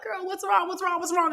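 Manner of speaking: high, mock-worried coworker voice. Coworkers phoning her about her leaving, high mock-worried voice. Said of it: "calling me like".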